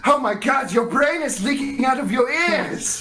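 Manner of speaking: high-pitched mock panic; feigned horror. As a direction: exclaim in hammed-up alarm to scare them. Prankster feigns horror to scare blindfolded player.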